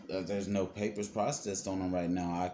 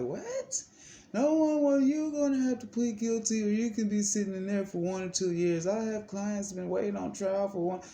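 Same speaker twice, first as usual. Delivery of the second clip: high, put-on lawyer voice; high mock of the lawyer pushing the plea; 10 semitones higher than usual. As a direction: parody the lawyer's pressure, way up high. Mocking lawyer pushing a guilty plea.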